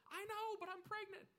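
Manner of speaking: very high squeaky voice; high falsetto glee; giddy Sarah. Retold or imitated. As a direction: chirp it like a delighted old woman. Sarah answers the friend.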